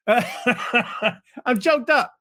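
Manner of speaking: mock-tearful chuckle; laughing. Real, not acted. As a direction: laugh through the words. Joking about being choked up.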